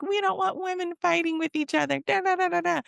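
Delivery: high-pitched mocking whine of objectors; sing-song, dismissive parody of critics; mocking complainers. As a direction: mimic the naysayers in a silly high voice. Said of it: "I know people are like".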